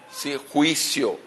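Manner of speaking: "juicio" drawn out slow and weighted; slow stressed "juicio". Shown as a demonstration. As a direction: lean hard on "juicio", slowly. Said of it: "¿Por qué enfatizo la palabra?".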